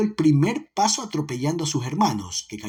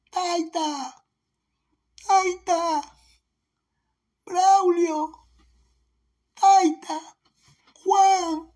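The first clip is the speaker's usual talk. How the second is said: high, loud, desperate calls after her father; a frightened girl calling her family back into the dark; calling out in tears; 5 dB louder, much slower than usual. A narrator's reading. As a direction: cry out for the taken men, much higher and louder. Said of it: "llamando a gritos".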